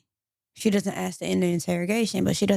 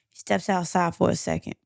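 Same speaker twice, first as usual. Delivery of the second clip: ordinary delivery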